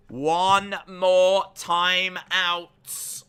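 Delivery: very slow, high and loud, every word stretched; booming mock warning. Streamer warning chat of one timeout left, hugely drawn-out call. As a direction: drag each word out as a big warning.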